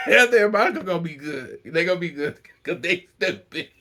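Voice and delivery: giggling through the words; words squeezed out between laughs; laughing. Real, not acted. Laughing while judging the friends.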